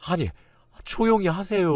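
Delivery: high-pitched, alarmed plea; panicked hushing. A narrator's reading. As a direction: urgently hush someone, voice jumping high. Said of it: "남편은 그 말에 사색이 되며". (사색이 되어 높은 목소리로 다급하게 말리는 말투)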